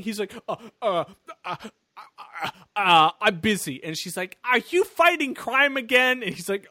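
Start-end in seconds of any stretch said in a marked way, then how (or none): 0.5-3.8 stammering, stalling excuse; mock flustered evasion. Hero answers sister while chasing a guy, mock flustered. 4.4-6.2 high, loud suspicious question; mock accusing sister. She's calling you mid-chase, mock accusing sister.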